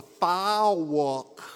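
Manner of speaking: "file walk" for fork, drawn into two syllables; Southern two-syllable pronunciation. Retold or imitated. Son imitating his mother's word for fork, Southern "file walk". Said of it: "two syllables out of a utensil".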